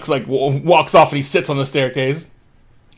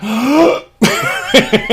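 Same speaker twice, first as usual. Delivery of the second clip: big gasp; 10 semitones higher than usual. Real, not acted. Player getting ready to hold their breath, gasping. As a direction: gulp air to hold your breath. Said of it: "I gotta hold my breath".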